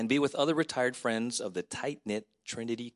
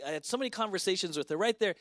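normal talking voice

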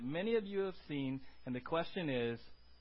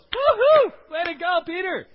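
high, loud whooping shout; cheering shout; 11 semitones higher, 16 dB louder than usual. Acted. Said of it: "and Jesus goes".

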